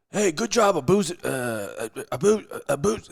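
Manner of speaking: hammed-up Texas coach calling out; Texas coach accent. Coach hollering at his freshman player, Texas accent. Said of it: "I like that Texas accent".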